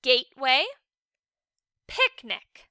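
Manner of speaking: gateway with long A sounds, picnic with short I sounds; model words. Shown as a demonstration. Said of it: "as I say the two words below".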